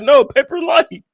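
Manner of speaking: high quote squeezed out through laughter; laughing quote. Retold or imitated. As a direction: still cracking up while quoting. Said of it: "He was like".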